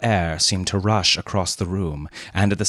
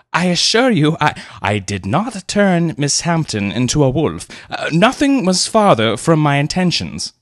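higher, louder flustered protest; 8 semitones higher, 4 dB louder than usual. A narrator's reading. Leonard protesting to the Colonel, flustered. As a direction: insist nervously. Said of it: "he protested".

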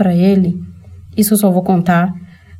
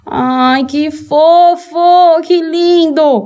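sarcastic high gush, drawn out, louder; mock gushing; 8 semitones higher, 3 dB louder, much slower than usual. Reacting to the husband's speech with sarcastic high gushing. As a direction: fake swooning at his sweet words.

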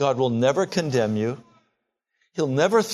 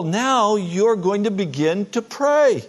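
usual speaking voice

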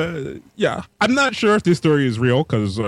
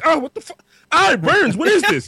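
put-on very high, panicked kid voice; hammed-up squealing alarm, pitched way up; kid in distress; 4 dB louder than usual. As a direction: cry out like a stung child. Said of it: "And the kid's like".

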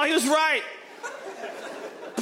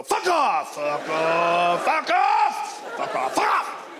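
snarling dog; much slower than usual. Retold or imitated. Dog park dog warning off every other dog, snarling. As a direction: bark it out like a nasty dog.

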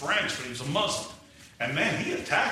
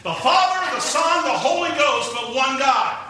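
loud, higher, rising proclamation; building preaching; 6 semitones higher, 5 dB louder than usual. Acted. Preacher proclaiming the Trinity to the church, rising. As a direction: ring out each name of the Trinity.

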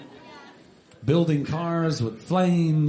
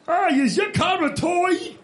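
taunting voice; 10 semitones higher than usual. Acted. Taunting the little car.

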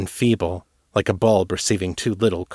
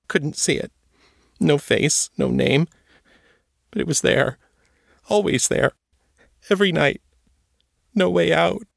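put-on high, small scared girl's voice; a haunted girl answering in fear; Trisha, frightened girl; 9 semitones higher than usual. A narrator's reading.

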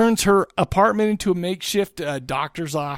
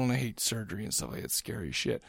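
muttered aside; 8 semitones lower, 12 dB quieter than usual. Real, not acted. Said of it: "muttered aside".

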